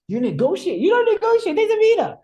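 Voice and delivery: very high, loud mock disbelief; incredulous outcry. Homestay host reacting to taxi negotiation, loud and incredulous. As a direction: imitate an appalled host, pitch shooting up. Hosts shocked at his negotiating.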